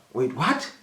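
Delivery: imitated puzzlement with rising end; mock baffled reply; feigned confusion. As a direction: play dumb, questioning upward. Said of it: "acting like he doesn't understand".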